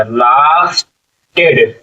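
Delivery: Lasted with a long held ला, pause before टेड; slow sounding-out. Shown as a demonstration. Teacher showing the long vowel in lasted, drawn out slowly. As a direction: stretch lasted out in two slow parts. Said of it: "आ की मात्रा".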